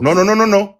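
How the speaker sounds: loud repeated no; loud protest. Real, not acted. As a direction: cut him off hard. Cutting off in heated debate.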